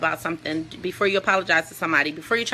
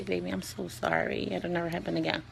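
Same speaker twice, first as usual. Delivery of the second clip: sexy whisper in the ear; 2 semitones lower, 12 dB quieter than usual. Shown as a demonstration. Demonstrating how to apologize during sex, soft and sexy. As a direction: murmur it close to her ear like a seduction. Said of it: "you gotta say it real sexy in the ear".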